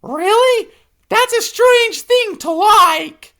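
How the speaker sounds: very high, loud, slow squeak; baffled hippo. Acted. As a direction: sound puzzled and put off.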